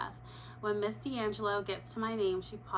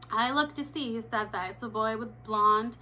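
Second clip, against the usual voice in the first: ordinary delivery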